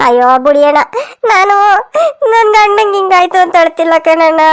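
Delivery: slow, sobbing lament; hammed-up tearful grieving; acted crying. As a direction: break into theatrical sobs between phrases. Wife weeps after husband's accident news.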